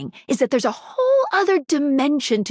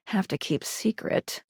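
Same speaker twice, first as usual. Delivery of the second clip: much softer, hushed aside; hushed secretive; 4 semitones lower, 8 dB quieter than usual. Acted. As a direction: drop to a near-whisper, guarding a secret. Hinting at a secret.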